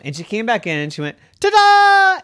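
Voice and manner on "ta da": high, loud drawn-out fanfare; triumphant ta-da. A narrator's reading. Little gymnast announces herself to the family, triumphant. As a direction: belt it out like a little show-off. Child showing off on entering.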